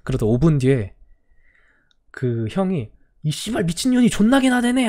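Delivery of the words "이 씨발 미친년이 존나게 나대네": higher, furious swearing rant; angry cursing. Retold or imitated. Student cursing the young teacher, furious. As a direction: curse at the teacher in a rage.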